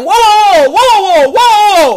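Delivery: long, loud, high-pitched shouts; big booming calls of alarm, imitating the coaches; shouted whoa. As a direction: holler to halt someone, drawing each one out. Coaches calling out to stop her.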